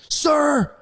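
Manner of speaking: high, drawn-out recruit reply; recruit's startled "sir". Retold or imitated. Recruit caught by the drill instructor, startled high sir.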